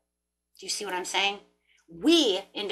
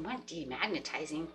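muttered to herself; 5 semitones lower, 9 dB quieter than usual. Retold or imitated. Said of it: "sitting there thinking".

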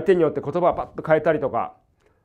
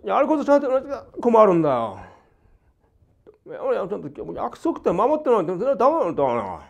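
mock quarrel; 5 semitones higher than usual. Shown as a demonstration. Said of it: "口論がありまして".

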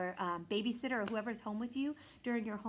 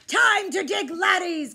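much higher, very loud pirate order; loud command; 7 semitones higher, 12 dB louder than usual. A narrator's reading. Pirate captain ordering the crew to dig. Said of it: "commanded Braidbeard".

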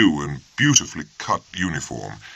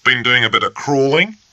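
louder, sneering taunt; first lieutenant taunting Lockhart, sneering and louder; 8 semitones higher, 3 dB louder than usual. A narrator's reading. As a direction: sneer the question. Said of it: "sneered Bennett".

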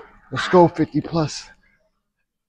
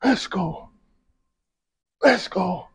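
ordinary talk

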